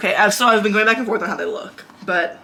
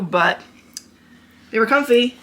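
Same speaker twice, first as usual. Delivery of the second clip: ordinary delivery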